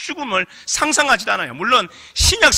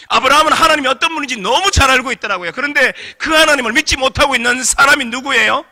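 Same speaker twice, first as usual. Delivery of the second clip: fervent preaching; 5 semitones higher, 6 dB louder than usual. Real, not acted. Preaching at full force.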